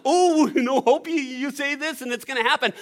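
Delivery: loud, high mock enthusiasm; hammed-up gushing of a prosperity preacher; prosperity-gospel believer. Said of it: "health and wealth gospel kind of".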